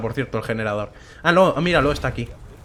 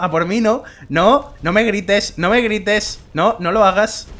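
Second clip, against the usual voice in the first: pleading; 5 semitones higher, 4 dB louder, slower than usual. Real, not acted. Pleading with dragon.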